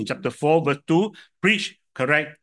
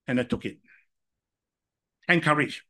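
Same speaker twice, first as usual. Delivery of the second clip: ordinary delivery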